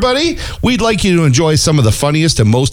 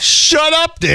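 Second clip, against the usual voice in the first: mock shout; 12 semitones higher, slower than usual. Mock quarrel over long joke.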